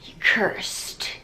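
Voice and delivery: whispered curse; ominous whisper. Retold or imitated. Mother warns Carrie she is cursed, whispered. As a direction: hiss it like a dark secret. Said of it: "Carrie's mom is just like".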